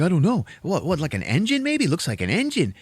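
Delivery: high, unsure guessing; baffled friend. Retold or imitated. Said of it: "He's like".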